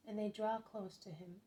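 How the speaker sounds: usual speaking voice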